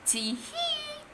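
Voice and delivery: very high quiet tee hee; sneaky giggle. Acted. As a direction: giggle at your own trick. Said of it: "thought Horrid Henry".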